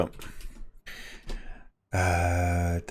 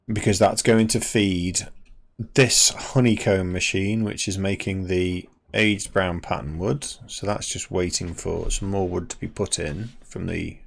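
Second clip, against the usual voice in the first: ordinary delivery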